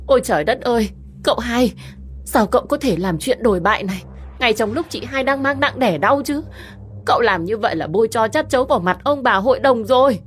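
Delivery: high, cutting, falsely indignant; steely mock outrage. A narrator's reading. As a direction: needle him with fake righteousness. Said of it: "đanh thép đầy giả tạo". (giọng đanh thép đầy giả tạo)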